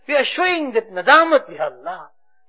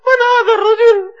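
higher, louder stern questions; interrogating angel; 8 semitones higher, 5 dB louder than usual. Retold or imitated. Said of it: "they will ask us".

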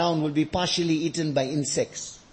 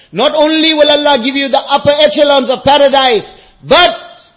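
loud, raised, emphatic promise; impassioned preaching; 8 semitones higher, 11 dB louder than usual. Real, not acted. Preacher promising paradise to the congregation, impassioned. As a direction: preach the promise at full force.

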